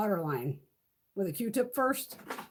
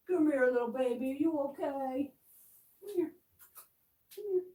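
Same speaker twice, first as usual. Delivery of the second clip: cooing to a kitten; 3 semitones higher than usual. Real, not acted. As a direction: gently lure the kitten closer. Coaxing a scared kitten.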